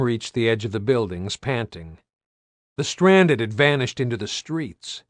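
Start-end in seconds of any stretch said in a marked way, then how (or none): none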